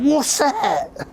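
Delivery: high, strained, throaty impersonation; pinched high voice of the old boxer; John Weller voice. Retold or imitated. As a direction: do the manager baffled by the cash. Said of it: "in only the way that John could say it".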